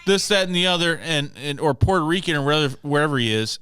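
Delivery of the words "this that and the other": fast, loud boastful show-off imitation; hammed-up bragging guy; mock braggart. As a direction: strut through it like a cocky loudmouth. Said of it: "he was like".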